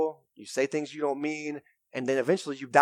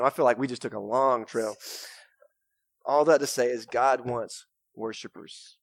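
usual speaking voice

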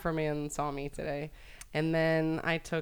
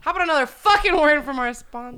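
higher and louder mock-angry outburst; mock mad; 10 semitones higher, 10 dB louder than usual. Copying him to get mad too before the ad. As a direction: fake a tantrum. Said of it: "I wanna get mad, too".

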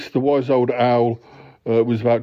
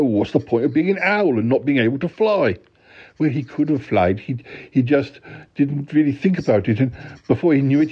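normal talking voice

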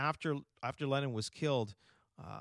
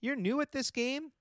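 teasing; 11 semitones higher than usual. Real, not acted. Teasing cohost.

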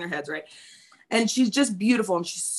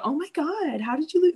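a hint of a gushing high adult; mock gushing; 9 semitones higher than usual. Adult gushing at a fourteen-year-old, mild mock gush. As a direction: lightly imitate a gushing grown-up. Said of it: "walk up to a fourteen-year-old and go".

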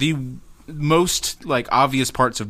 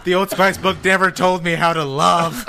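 higher, hammed-up lament; mock anguish; 5 semitones higher than usual. Host joining the Old Spice joke, mock anguish. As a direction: wail it in pretend heartbreak.

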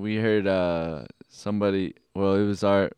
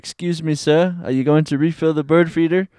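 raised, polite British voice; British accent; 6 semitones higher than usual. Acted. Sister's caption for the squirrel, in a British accent. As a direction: ask it in a higher British accent. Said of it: "I put a British accent on that one".

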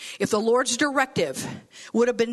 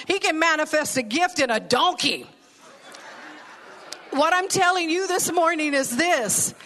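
ordinary talk, nothing marked

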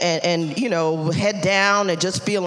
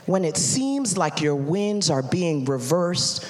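Holy Spirit speaking; 6 semitones lower, 4 dB quieter, slower than usual. Acted. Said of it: "and he said".

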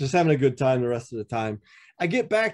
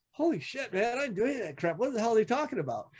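high, softer, rushed protest; retold alarmed disbelief; 7 semitones higher, 6 dB quieter, faster than usual. Prospect reacting to an accusing letter, retold alarmed disbelief. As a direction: replay a stunned, hurried denial in a small high voice.

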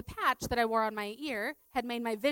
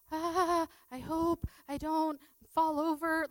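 mock unsteady worry; 7 semitones higher, 5 dB louder, slower than usual. Retells wobbling dizzily while teaching.

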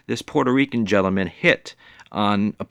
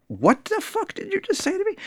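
much higher, outraged retort; offended woman. Retold or imitated. Woman the narrator expected to snap at the catcaller, high outrage. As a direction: throw back a shocked furious question. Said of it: "I'm expecting her to turn around and be like".

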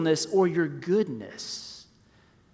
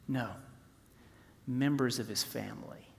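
soft answer; 5 semitones lower, 10 dB quieter than usual. Real, not acted. Soft answer after rhetorical questions.

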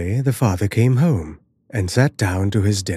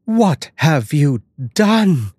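slow, raised-pitch shock; horrified mother; 6 semitones higher, 4 dB louder, much slower than usual. Acted. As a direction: draw the question out in horror. Horrified mother confronts her daughter.

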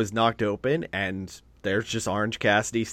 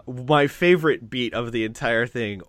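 ordinary delivery

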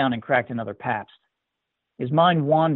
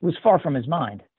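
ordinary delivery, nothing marked

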